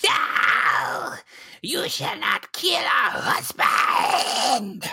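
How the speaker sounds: harsh, high, drawn-out shriek; screaming vampire spawn. Acted. Slashed vampire spawn screams at her attackers, anguished shriek. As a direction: scream in anguish, stretching the words out. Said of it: "as she screams".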